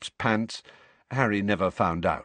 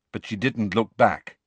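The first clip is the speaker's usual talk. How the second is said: normal talking voice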